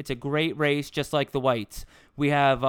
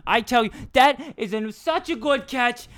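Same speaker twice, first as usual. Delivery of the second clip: much higher, gushing female broadcaster voice; Susan Waldman; 8 semitones higher, 3 dB louder than usual. Pretend. Imitating a female baseball broadcaster praising a catch, very high voice. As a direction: gush over the catch, way up high. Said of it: "my Susan Waldman imitation".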